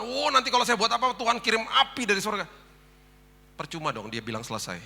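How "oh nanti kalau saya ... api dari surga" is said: frightened churchgoer. Retold or imitated. Frightened congregant quoted.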